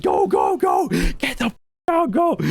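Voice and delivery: high, urgent getaway yell; frantic urging. Retold or imitated. Buddies booking it out of there, retold high frantic shouts.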